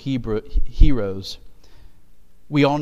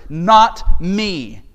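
"Not me." hit hard and louder on both words; emphatic; 2 semitones higher, 9 dB louder than usual. Shown as a demonstration. Joseph refusing credit before Pharaoh, emphatic. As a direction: punch "Not me." as a firm refusal. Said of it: "it's emphatic".

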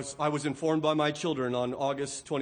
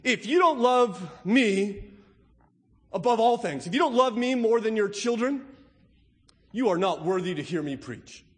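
pretend pulpit demand; 4 semitones higher, 5 dB louder than usual. Pastor pretending to make Jesus' demand himself, loud. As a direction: hurl the demand at the pews as a pretend preacher. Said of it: "Can you imagine if you came here".

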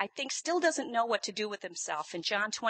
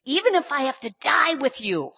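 loud, high-pitched pledge; sure of himself; 5 semitones higher, 12 dB louder than usual. Retold or imitated. Peter pledging to die with Jesus, loudly sure. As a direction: proclaim it with total confidence. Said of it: "He's so sure of himself".

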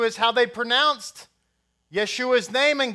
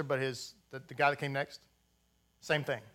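ordinary talk

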